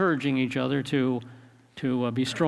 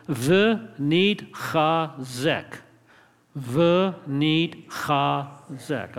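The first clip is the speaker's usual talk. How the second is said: very slow, each syllable separated; slow syllable-by-syllable demo; much slower than usual. Shown as a demonstration. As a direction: teach it one drawn-out syllable at a time. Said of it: "the third word is a little more complicated".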